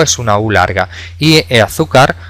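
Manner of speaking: usual speaking voice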